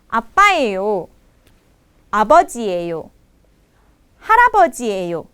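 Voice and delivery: usual speaking voice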